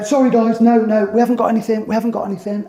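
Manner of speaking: high, loud, hurried refusal, retold; mock-flustered, pitched well up; flustered customer. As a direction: imitate a householder heading off visitors. Customer rushing down to turn them away.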